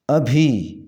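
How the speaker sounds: ابھی alone, a single "bhi": ab-hi; correct form. Shown as a demonstration. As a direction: model ابھی with nothing after it. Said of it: "اس کا صحیح تلفظ ہے". (ابھی کا صحیح تلفظ، ایک ہی "بھی")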